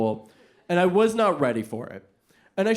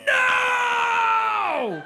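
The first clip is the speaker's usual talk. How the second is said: group outcry. Retold or imitated. Class of teenagers reacting to his rising sign, horrified shout. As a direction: cry out together in dismay.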